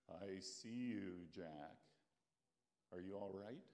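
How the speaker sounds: God reassuring Jack on the cliff, calm and low. Acted. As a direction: speak from above, serene. God speaks calmly to Jack.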